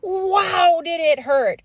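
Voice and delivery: high, loud burst of surprise; big storyteller outburst; excited exclamation. A narrator's reading. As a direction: shout it like a sudden sting. Bee stings Ferdinand, dramatic exclamation.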